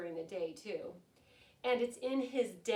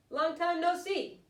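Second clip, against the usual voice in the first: higher, louder, friendly put-on voice; breezy hare voice; 7 semitones higher, 9 dB louder than usual. Hare greeting his old friend Mouse, breezy put-on voice. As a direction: play the chummy hare.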